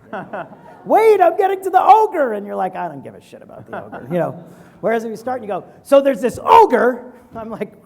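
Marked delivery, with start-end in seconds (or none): 0.9-2.3 much higher and louder, pleading to be heard; a storyteller begging the bored listener to wait, loud and high; mock frantic storyteller. Founder pleading with a bored investor, a high loud frantic cry. Rambling pitcher acted out. 2.7-4.1 mock bored dismissal. Bored investor acted out. 5.8-7.1 slow and much louder, a bold announcement; dramatic storyteller opening. Retold or imitated. Founder opening a pitch with the big hook, loud and slow.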